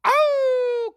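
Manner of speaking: long, high imitated dog howl; high-pitched hound's howl, drawn out. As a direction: howl like a dog, sliding slowly down. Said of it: "crying and howling".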